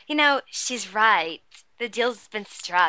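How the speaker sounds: slow, theatrical trickster voice; Rumpelstiltskin. Retold or imitated. Quoting Rumpel's line about the deal, slow put-on trickster voice. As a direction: draw it out like a scheming imp. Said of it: "And Rumpel's like".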